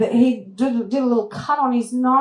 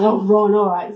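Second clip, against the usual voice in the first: laughing resigned; 6 dB louder than usual. Retold or imitated. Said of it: "I'm like".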